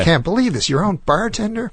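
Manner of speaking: high-pitched incredulous straight man; astonished setup-man question; feigned disbelief. Retold or imitated. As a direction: act amazed at the comic's story, pitch climbing.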